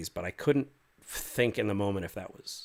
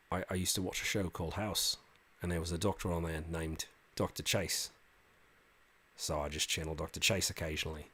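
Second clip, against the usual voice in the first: ordinary talk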